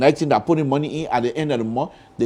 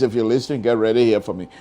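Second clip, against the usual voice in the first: ordinary talk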